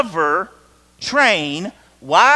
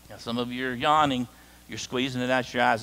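normal talking voice

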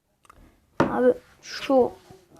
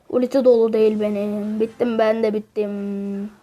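ordinary delivery

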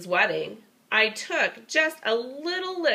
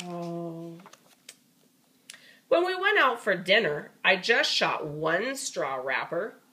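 usual speaking voice

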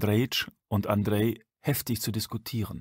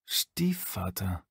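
slow, breathy whisper; a stranger musing over the word, whispered slowly; whispered to himself; 2 semitones lower, 5 dB quieter, much slower than usual. Acted.

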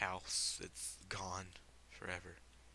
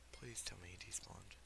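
whispered plea, much softer; pleading whisper; 12 dB quieter than usual. Real, not acted. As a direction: beg under your breath. Hoping the ogre vanished.

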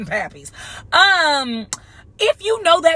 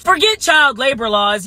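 normal talking voice

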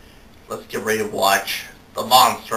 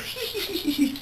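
high cackle; 7 dB quieter than usual. Acted. Vampire laugh.

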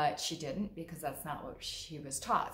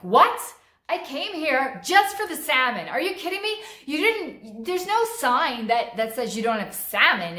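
much higher and louder, furious complaint; overblown outrage; 9 semitones higher, 14 dB louder than usual. Shown as a demonstration. Customer told salmon is gone, ranting at the waiter. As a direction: blow up at the waiter over the salmon. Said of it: "and I'm like".

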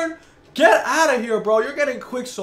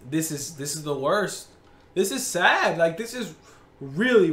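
usual speaking voice

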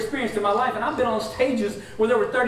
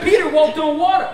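hyped-up pastor; 7 semitones higher, 8 dB louder than usual. Retold or imitated. Pastor hyping him up.

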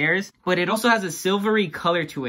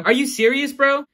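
incredulous; 9 semitones higher, 3 dB louder than usual. Real, not acted. Repeated higher, incredulous.

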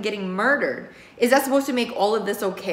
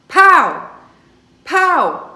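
two sharp, much louder bursts of Pow!; gunshot sounds; 9 semitones higher, 11 dB louder than usual. Retold or imitated. Star reliving the shooting for the detectives, loud gunshot bursts. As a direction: spit out each Pow! like a gunshot, high and loud.

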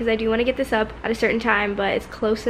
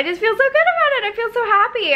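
happy; 11 semitones higher than usual. Real, not acted. Celebrating weight loss.

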